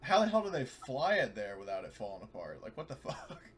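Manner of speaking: ordinary delivery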